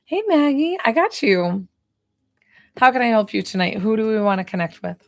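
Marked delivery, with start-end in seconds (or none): none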